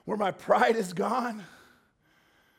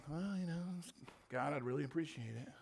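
much softer, lower offhand prayer; lukewarm prayer; 4 semitones lower, 14 dB quieter than usual. Retold or imitated. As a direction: toss off a polite shrug of a prayer.

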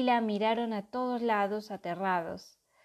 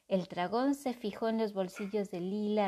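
ordinary delivery, nothing marked

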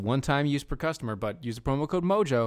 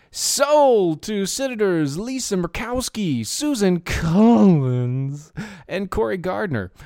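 auctioneer voice; 6 semitones higher, 5 dB louder, slower than usual. Acted. Auctioneer bit going once twice.

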